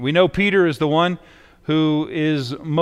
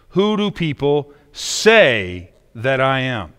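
pause, then hit "say" hard; stress on "say"; slower than usual. Shown as a demonstration. Asking what Jesus means, heavy stress on "say". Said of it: "What does he mean when he says".

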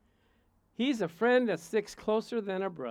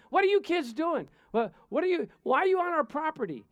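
put-on loud, higher scolding of the kids; retold yelling; 8 semitones higher, 5 dB louder than usual. Neighbor yelling at young trespassers, loud and high. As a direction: yell at them to get off the property. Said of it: "they start yelling at 'em".

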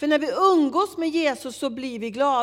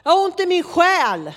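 loud, high-pitched cry of complaint; retold anguished outburst; 7 semitones higher, 9 dB louder than usual. Stranger unburdens himself to her on the metro, loud outburst. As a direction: blurt it out, raised and urgent.

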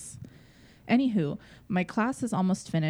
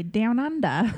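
put-on Australian accent; 4 semitones higher, 3 dB louder, slower than usual. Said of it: "Can you do an Australian accent?".

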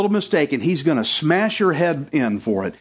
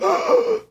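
acted high gasp of dread; frightened gasp. Elders see Samuel arrive, frightened gasp. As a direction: gasp in mock terror.

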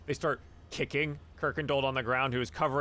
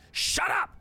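high-pitched yell to silence someone; bully's sharp yell. Acted. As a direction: cut them off with a sudden high shout. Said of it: "He's like".

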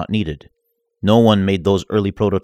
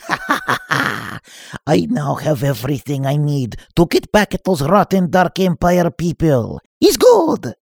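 much higher cackling gloat; evil triumphant laugh; 9 semitones higher than usual. Acted. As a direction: cackle like a villain. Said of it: "villain laughter".

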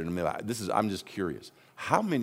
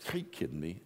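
dismayed introvert; 4 semitones lower, 10 dB quieter than usual. Retold or imitated. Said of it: "introverts are going".

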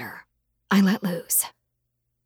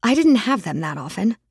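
ordinary delivery, nothing marked

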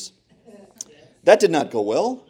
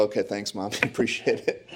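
mumbled thanks; 3 semitones lower, 8 dB quieter than usual. Retold or imitated. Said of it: "read it and go".

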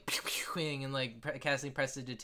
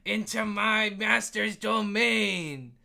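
high, loud, drawn-out dragon proclamation; kid playing dragon; 7 semitones higher, 6 dB louder, much slower than usual. Game master as a kid voicing a dragon, grand challenge. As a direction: boom it slowly like a grand guardian. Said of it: "And then the kid goes".

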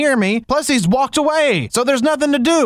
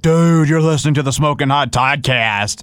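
lower, laid-back cool guy read; cool dude voice; 7 semitones lower than usual. Shown as a demonstration. DJ reads the promo line as a cool dude. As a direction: play it way too cool, like a surfer. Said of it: "some way cool".

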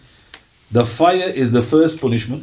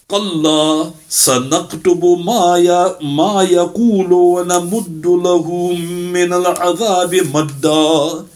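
brisk chanted Quran recitation, a little higher; 4 semitones higher, much faster than usual. Acted. Preacher recites the verse to the congregation, chanted. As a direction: intone the verse in Arabic recitation style.

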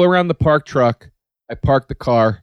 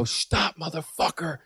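mock scream; 2 semitones higher, 10 dB quieter, slower than usual. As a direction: yell it under your breath, imitating a scream. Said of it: "I'm screaming".

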